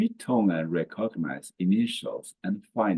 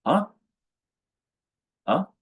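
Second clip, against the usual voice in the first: "Ah" very short and quick; neutral tone; 10 semitones higher, 4 dB louder than usual. Shown as a demonstration. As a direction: let "Ah" go in one brief beat. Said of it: "soft and quick".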